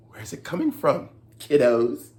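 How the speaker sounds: ordinary delivery, nothing marked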